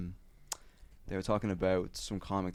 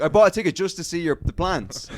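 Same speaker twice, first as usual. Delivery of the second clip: raised, loud retold complaint; mock outrage; 8 semitones higher, 9 dB louder than usual. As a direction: imitate a punter protesting at the comic. Retold angry punter complains.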